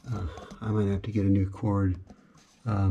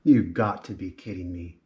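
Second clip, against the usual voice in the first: normal talking voice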